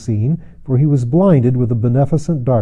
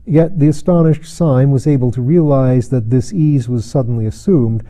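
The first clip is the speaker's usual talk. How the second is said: plain reading voice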